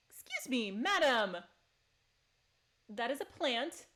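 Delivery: prim mock outrage at a pet; mock scolding. Real, not acted. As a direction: scold the cat like a stuffy butler. Scolding kitten eating chives.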